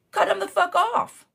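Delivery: heated advice. Real, not acted. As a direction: lay it down hard.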